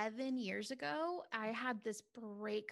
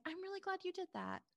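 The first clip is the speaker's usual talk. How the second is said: husband's calm reply; 9 semitones higher than usual. Retold or imitated. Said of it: "his response was like".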